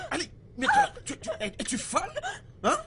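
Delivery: angry outburst. Acted. Master raging at maid.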